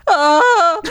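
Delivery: ordinary talk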